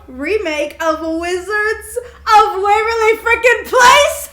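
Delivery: loud, slow outraged disbelief. Real, not acted. Outrage at beloved show remake.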